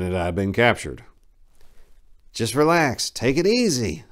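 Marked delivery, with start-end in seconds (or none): 2.3-4.0 higher, louder soothing voice; calming stranger. Acted. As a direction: coax a captive to relax. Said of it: "a voice said".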